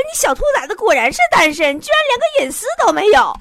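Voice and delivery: mock-furious mother snapping at her daughter over the phone; mom flaring up. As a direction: scold like a mom who just lost her temper. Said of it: "我妈当时急眼了".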